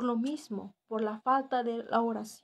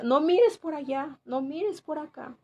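lightly imitated mum giving orders; retold maternal warning, pitched a little up; a touch of a nagging mother; strict mother; 4 semitones higher, 3 dB louder than usual.

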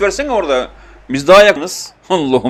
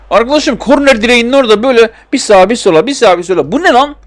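much higher, louder mocking disbelief building to a shriek; incredulous rant; 8 semitones higher, 6 dB louder than usual. Real, not acted. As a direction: rant like the game is making fun of you. Mocking game AI wasting time.

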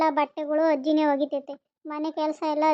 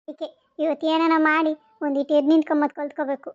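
usual speaking voice

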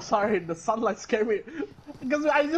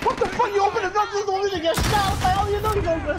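high, loud exasperated yelling; angry yelling; 11 semitones higher, 5 dB louder than usual. Real, not acted. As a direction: yell at careless teammates. Teammates opened the door.

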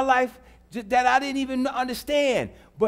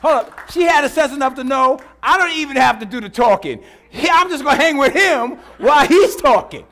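loud, punched-out preacher delivery; emphatic preaching; 7 dB louder than usual. Real, not acted. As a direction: drive it home loudly to the congregation. Preaches at full force.

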